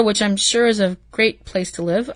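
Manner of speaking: ordinary delivery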